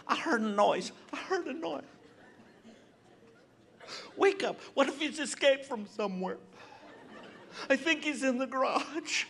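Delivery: high, frightened wife's voice; scared wife. Retold or imitated. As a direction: play the nervous wife waking him at night. Wife voiced waking him, scared.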